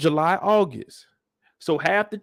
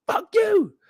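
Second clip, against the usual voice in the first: much higher, shouting mock teen; furious son. Son screaming hatred at his father, high shout. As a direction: yell it at him. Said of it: "[name]'s like".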